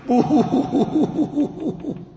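rapid high staccato sobs, fading away; high-pitched mock sobbing in short pulses; quick crying. Shown as a demonstration. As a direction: imitate quick boo-hoos tailing off to breath. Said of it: "they cry quickly".

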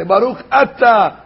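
"Atah" started cleanly, no ch; clear aleph. Shown as a demonstration. Rabbi demonstrating the correct blessing. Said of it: "Don't swallow the Aleph".

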